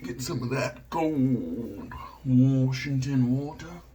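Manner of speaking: very slow, drawling imitation; Flash Boredom. Shaver getting some water, imitating another shaver, drawn out. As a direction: stretch each word out long and lazy. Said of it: "like Flash Boredom says".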